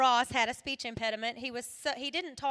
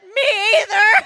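mock sob; 8 semitones higher, 15 dB louder than usual. Mom agreeing after the terrifying trip.

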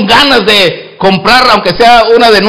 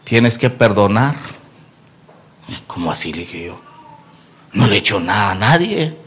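lower, softer voice of God; 9 semitones lower, 7 dB quieter than usual. Acted. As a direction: speak as God, low and grave. God's voice quoted low. (voz de Dios, grave y baja)

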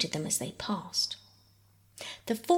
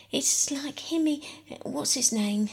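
gasping; 7 semitones higher than usual. Acted. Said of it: "gasped Philip".

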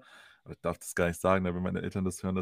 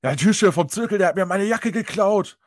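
higher, louder mock drunk shouting; imitated phone yell; 8 semitones higher, 8 dB louder than usual. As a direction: bellow it into the receiver like a drunk. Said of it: "schreit einfach ins Telefon".

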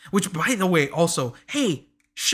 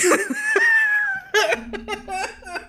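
high shrieking laugh. Real, not acted. Laughing at own joke.

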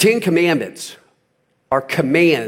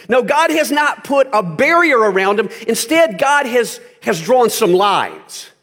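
raised, impassioned sermon voice, higher; full-force preaching; 7 semitones higher than usual. Acted. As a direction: preach it hard to the congregation.

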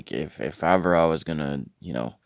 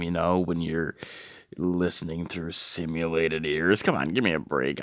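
ordinary talk, nothing marked

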